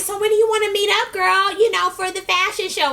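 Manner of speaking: high, fast eager ask. Retold or imitated. [name] asking [name] about the fashion show, high and quick. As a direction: rush it eagerly.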